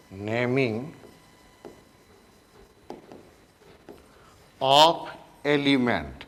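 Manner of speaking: very slow, word by word for note-taking; slow dictation. Shown as a demonstration. Teacher dictating a heading to students, very slowly. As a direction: spell it out slowly so students can write.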